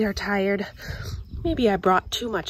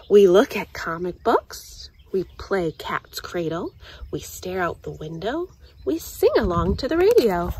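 ordinary delivery

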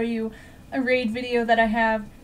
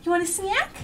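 squeaky praising a dog; 7 semitones higher than usual. Real, not acted. Coaxing dog.

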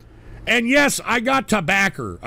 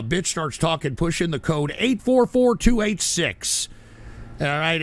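ordinary talk, nothing marked

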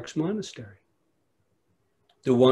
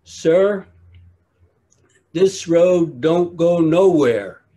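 put-on loud earnest country boy; 5 semitones higher, 6 dB louder than usual. Boy answers the monk with loud earnest literalness. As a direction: call it out plainly like a backwoods boy.

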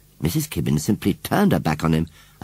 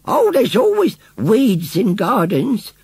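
put-on much higher, slow old village woman; old lady voice; 13 semitones higher, 4 dB louder, slower than usual. A narrator's reading. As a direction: play a dry old countrywoman, drawn out. Old lady Mrs Kibbins voiced.

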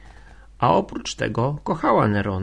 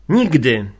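loud, sharp "Nigdy" that falls hard; outburst; 8 dB louder than usual. A narrator's reading. Ligia refusing Winicjusz, loud outburst. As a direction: burst out with "Nigdy". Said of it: "zawołała z wybuchem".

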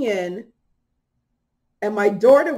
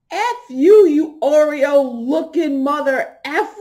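high, loud, slowed yell; shouted curse; 5 semitones higher, 7 dB louder, slower than usual. Retold or imitated. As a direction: yell it right in her face. Woman cursing her out.

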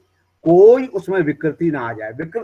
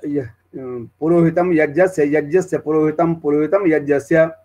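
jata recitation. Shown as a demonstration. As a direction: chant the pairs forward, back and forward again. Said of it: "एक पाठ का example आपको दे देता हूँ".

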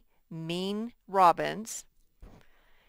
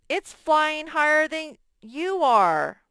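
proud Frog; 9 semitones higher, 7 dB louder, slower than usual. Acted. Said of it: "Or something like".

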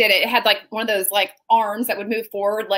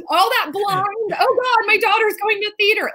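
high-pitched fretting parent; high, mock-anguished voice; parental dismay; 10 semitones higher than usual. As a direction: imitate a worried parent wailing. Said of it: "like that kind of".

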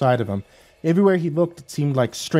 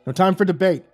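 snapped; 7 semitones higher than usual. Acted. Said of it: "Hazan snapped at him".

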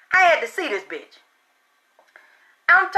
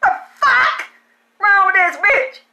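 outraged shout; 5 semitones higher than usual. Retold or imitated. Mom yelling about girl.

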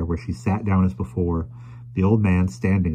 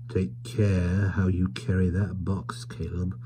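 hushed warning; 5 dB quieter, slower than usual. Acted. Tackleton warning Caleb on leaving.

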